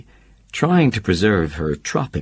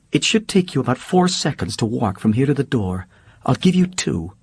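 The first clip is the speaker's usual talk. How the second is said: higher voice, icy and final; Holly cold dismissal; 6 semitones higher than usual. Acted. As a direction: throw him out as Holly.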